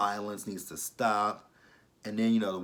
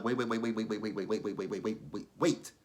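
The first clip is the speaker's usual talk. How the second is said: quick, stuttering stop-everything repetition; rapid-fire interjection; 3 dB quieter than usual. Retold or imitated. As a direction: rattle off a string of waits. Reacts in alarm to a story.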